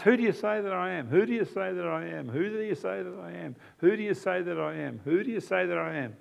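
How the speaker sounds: soft, pleading question asked over and over; quiet, insistent imploring. Acted. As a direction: gently beg them to answer. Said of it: "It's an imploring".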